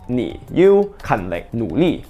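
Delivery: ordinary talk, nothing marked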